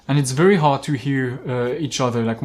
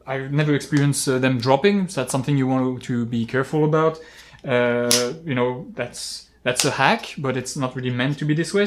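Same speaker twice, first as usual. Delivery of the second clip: usual speaking voice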